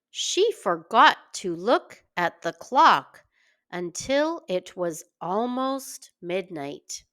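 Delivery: ordinary delivery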